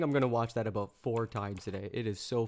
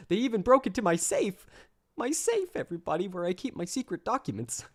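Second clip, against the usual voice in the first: petulant whiny Trump; 5 semitones higher than usual. Pretend. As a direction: whine like a wounded rich kid, peaking on safe. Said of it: "in a petulant voice".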